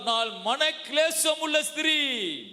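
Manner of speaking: high, slow, drawn-out cry of Hannah's verse; impassioned declamation. Acted. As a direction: proclaim it high, stretching the words. Verse declaimed, then repeated softly. (உயர்ந்த குரலில் நீட்டி முழங்கி)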